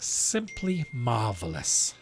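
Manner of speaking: put-on slow, drawn-out old-time compliment; old-fashioned phrase. To a radio contact, a slow old-fashioned compliment. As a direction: savour it like an old-time gentleman. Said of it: "how did they used to say that".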